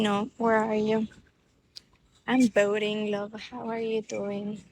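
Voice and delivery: ordinary talk, nothing marked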